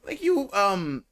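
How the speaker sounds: a real crack in the voice, unplanned. Real, not acted. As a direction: voice cracks mid-word. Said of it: "ooh, my voice just cracked there".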